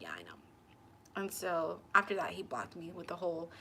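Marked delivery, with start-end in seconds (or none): 0.0-0.4 much lower, soft and resigned; deep resigned murmur. Retold or imitated. Agreeing with him in a low resigned murmur. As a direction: let it sink low and heavy.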